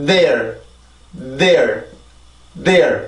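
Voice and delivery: "there" as one syllable with a long voiced TH; English TH onset. Shown as a demonstration. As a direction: build sound in the TH, stress the vowel, relax into R. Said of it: "자, 그럼 발음해보고 넘어갈게요". ("there"의 앞소리를 충분히 낸 일음절 발음)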